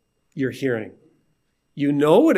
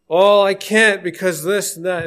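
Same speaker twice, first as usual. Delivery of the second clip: whiny excuse; 3 semitones higher, 5 dB louder than usual. Retold or imitated. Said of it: "excuse after excuse".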